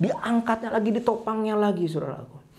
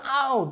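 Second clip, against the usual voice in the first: high yelp of "ow"; pained yelp; 8 semitones higher than usual. Acted. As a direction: yelp as if poked by a twig. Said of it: "dia alas sakit". (jeritan kecil kesakitan)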